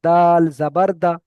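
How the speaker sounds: দাল জাবার দা, slow and loud, দা kept short; short Arabic reading. Shown as a demonstration. Teacher to online class, loud slow recitation. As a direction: say it slowly but keep দা short. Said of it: "এটা টান হবে না".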